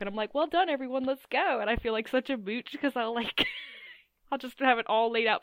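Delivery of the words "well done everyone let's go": cheerful. Retold or imitated. Retold cheerful self-quote.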